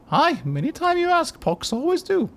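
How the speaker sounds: put-on very high, small girlish voice; girl of ten voice. Player voicing hooded Pox in a high girlish voice. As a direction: squeak it out like a ten-year-old girl. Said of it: "I'm doing a silly voice".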